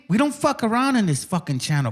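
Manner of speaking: ordinary talk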